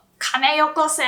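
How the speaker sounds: forceful mock hold-up; robber's demand. Shown as a demonstration. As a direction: demand cash like a bank robber. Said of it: "you can sound like a robber".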